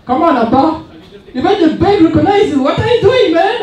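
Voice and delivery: high, loud mocking buddy; teasing friend. Friend teasing him at the bank, high and laughing. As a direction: rib your friend, voice climbing high with laughter. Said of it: "He said".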